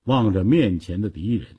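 usual speaking voice

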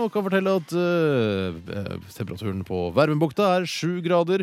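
loud, high-pitched DJ back-announcement; big upbeat presenter voice; radio DJ announcing. Acted. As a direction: project it like a summer radio host. Radio host back-announces song, reads temperatures.

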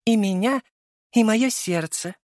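high, coy female voice; coy and high-pitched; sly mock-romantic. A narrator's reading. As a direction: lay on the romance teasingly, drawing out the end. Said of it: "хитро улыбаясь".

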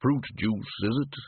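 lightly voiced rolling barman; a touch of deep, shadowy rumble. A narrator's reading. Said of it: "deep, rolling voice".

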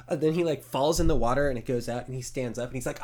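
ordinary talk, nothing marked

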